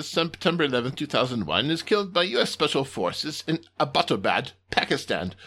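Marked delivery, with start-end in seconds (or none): none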